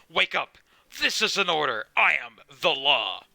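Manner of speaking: loud, hammed-up lawman's roar; roaring command. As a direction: bellow it like an officer giving orders. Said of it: "Roar like a hurricane".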